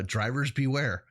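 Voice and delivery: usual speaking voice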